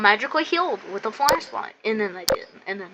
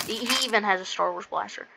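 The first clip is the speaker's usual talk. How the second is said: ordinary talk, nothing marked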